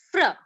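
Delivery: "fr" said as one quick blended sound. Shown as a demonstration. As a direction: run f into r without a vowel. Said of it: "And for F R, it is".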